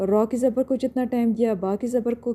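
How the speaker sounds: ordinary talk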